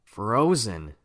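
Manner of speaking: usual speaking voice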